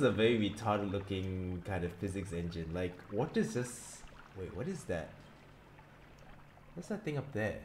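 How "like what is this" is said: ordinary talk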